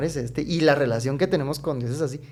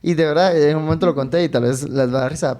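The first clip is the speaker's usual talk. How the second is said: usual speaking voice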